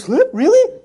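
shrieking high and loud in disbelief; the professor's squeal of shock; stunned disbelief. Retold or imitated. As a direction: professor reacts to the translation, high incredulous squeal. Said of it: "He goes".

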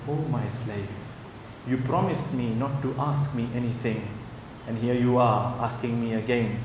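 soft, low retold divine voice; hushed, measured reminder of a promise; gentle reproach. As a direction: chide quietly, as master to servant. God gently chiding the man.

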